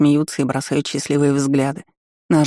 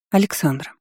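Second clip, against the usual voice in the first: ordinary delivery, nothing marked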